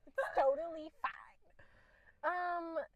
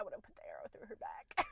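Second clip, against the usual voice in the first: whispered retold reaction; 7 dB quieter than usual. Retelling her reaction to a book, whispered. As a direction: whisper it like a dark secret.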